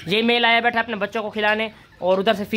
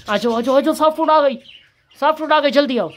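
calling the pets; 5 semitones higher, 3 dB louder than usual. Real, not acted. Calling rabbits out to eat.

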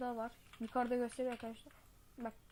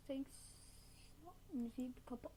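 ordinary talk, nothing marked

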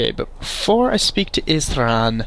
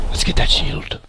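whisper. Real, not acted. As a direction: whisper it like sneaking up. Sneaking toward the shield.

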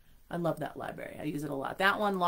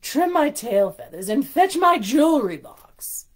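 loud, haughty commands; bossy order; 7 semitones higher, 12 dB louder, slower than usual. Acted. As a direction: boss the servant around. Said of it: "Largessa ordered".

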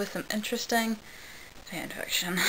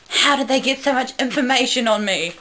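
louder, higher exclamation; exclaiming; 5 semitones higher, 5 dB louder than usual. Acted. Captain Jack seeing his Wikipedia page, exclaiming. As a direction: exclaim it, pitched up and forceful. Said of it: "He did, and exclaimed".

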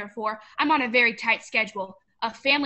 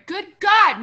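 exasperated exclamation; 8 semitones higher, 8 dB louder than usual. Acted. Potter exclaims at the business.

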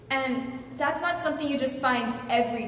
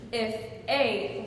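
usual speaking voice

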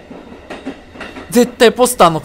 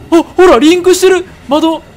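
excited; 7 semitones higher, 5 dB louder than usual. Real, not acted. Player spots change in game.

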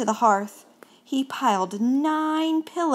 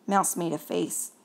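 ordinary delivery

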